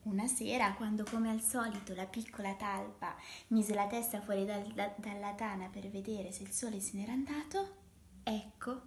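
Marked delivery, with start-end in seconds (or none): none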